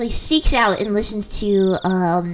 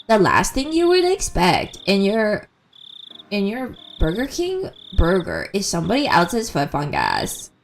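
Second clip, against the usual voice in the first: Chills; 3 semitones lower than usual. Retold or imitated. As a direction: stretch words with exaggerated rises and low drops. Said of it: "He goes like".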